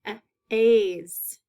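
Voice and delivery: pause, then a loud long A in aze; isolated long A. Shown as a demonstration. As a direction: sound out the ending a-, aze. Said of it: "You can hear the long A".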